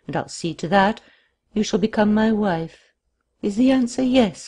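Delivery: a lightly voiced villain, pressing with held-back intensity; a hint of trembling passion; restrained passion. A narrator's reading. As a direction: urge her to answer, passion held in check. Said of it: "a restrained passion that set his voice trembling".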